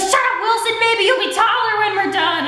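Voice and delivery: imitated fed-up teacher, high voice; exasperated teacher. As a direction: tell off a wailing pupil, high and exaggerated. Teacher snapping at stuck kid.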